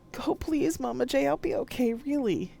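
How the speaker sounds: high, mildly tearful plea. Acted. Poe tearfully telling her mother's ghost to go, mildly tearful. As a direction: gently urge her to go through tears. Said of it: "with tears rolling down my cheeks".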